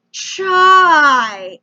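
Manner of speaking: CHAI drawn out slow and loud. Retold or imitated. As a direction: stretch CHAI out loudly, as if to someone who doesn't understand. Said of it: "he says it slower".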